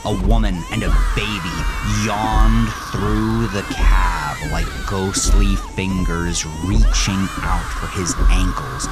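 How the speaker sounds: plain reading voice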